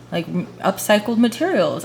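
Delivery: ordinary talk, nothing marked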